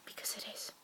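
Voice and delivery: breathy whispered joke tossed off; whispered aside. Real, not acted. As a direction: drop to a sly whisper for the punchline.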